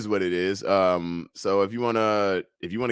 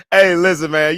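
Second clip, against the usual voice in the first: usual speaking voice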